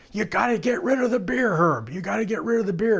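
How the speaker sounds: high call across the stage; mild mock yell; retold yell. Somebody yells at Herb.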